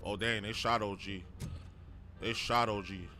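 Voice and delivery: ordinary delivery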